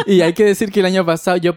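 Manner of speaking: talking through real laughter; laughing while talking. Real, not acted. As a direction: tell it still giggling. Laughing at own story.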